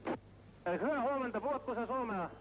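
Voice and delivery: viel höher, gehetzt, in Not; Seefunk. Real, not acted. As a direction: panischer Notruf über Funk. Notruf vom sinkenden Schiff. (angespannter Funkspruch in höchster Not)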